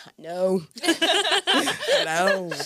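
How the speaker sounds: rueful laughing admission; laughing agreement. Retold or imitated. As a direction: laugh at your own mess. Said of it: "in my mind, I was like".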